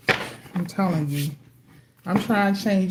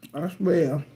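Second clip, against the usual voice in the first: ordinary talk, nothing marked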